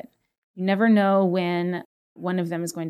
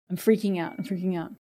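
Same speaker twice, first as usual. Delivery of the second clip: softer, hurried anxious self-talk under the breath; mock panicked muttering; 2 semitones lower, 7 dB quieter, much faster than usual. Shown as a demonstration. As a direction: spiral into a fretful mumble, fast and quiet. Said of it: "exaggerate your current attitude".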